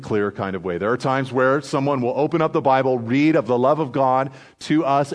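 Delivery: usual speaking voice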